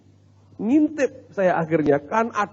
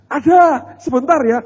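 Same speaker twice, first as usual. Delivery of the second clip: high, loud imitated reply; calling out; 9 semitones higher, 8 dB louder than usual. Wife telling the pastor to wait, imitated high loud call. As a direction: pitch it up as the wife answering the visitor.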